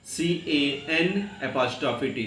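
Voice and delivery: ordinary delivery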